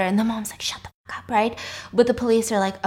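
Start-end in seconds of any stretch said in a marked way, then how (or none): 0.6-1.3 whispered order to shut up; acted sharp whisper; hushed scolding. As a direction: hiss it under the breath. Frantic mom silences the dad.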